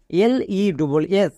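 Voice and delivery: slow spelling of L-E-double-S; teacher spells the letters for students. Shown as a demonstration. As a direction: read each letter slowly. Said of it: "লেস".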